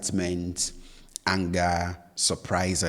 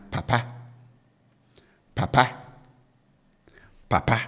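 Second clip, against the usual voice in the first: "papa" low first syllable, raised last syllable; low-high tone; 4 semitones higher, faster than usual. Shown as a demonstration. Teacher showing learners the third Twi word, low-to-high tone on "papa". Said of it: "tone on the first syllable is down".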